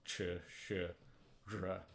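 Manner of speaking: "Ch, Sh, R" with the tongue curled back; "Ch, Sh, R" said retroflex; retroflex sounds. Shown as a demonstration. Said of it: "same tongue position as for J".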